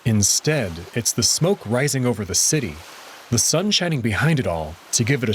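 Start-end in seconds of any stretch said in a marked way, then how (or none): none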